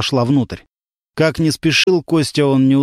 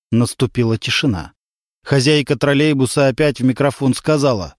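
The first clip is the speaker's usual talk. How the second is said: usual speaking voice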